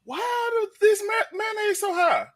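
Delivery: high, slow shouted mock mama; mama's outrage. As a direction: squawk it like an outraged mother. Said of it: "She was like".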